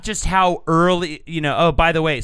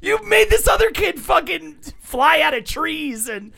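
high-pitched, shouted accusation; worked-up yelling; indignant rant; 12 semitones higher than usual. Real, not acted. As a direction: berate them in outraged disbelief. Ranting at the film makers.

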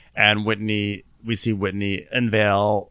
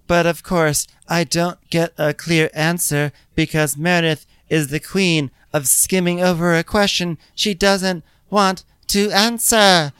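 high, deliberate put-on confessional voice; mock exasperation, rising and slowing at the end; Whitney; 9 semitones higher, 3 dB louder than usual. Podcaster mimics Whitney lacking a clear answer, high-pitched.

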